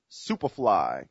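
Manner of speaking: ordinary delivery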